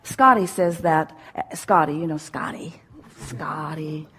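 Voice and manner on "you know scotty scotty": playful whisper. Pretend. Calling out Scotty playfully.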